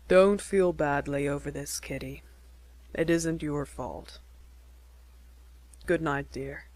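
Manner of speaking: a hint of a low, earnest young suitor; lightly voiced Jack. A narrator's reading. Young man proposing to Kitty, lightly voiced earnest.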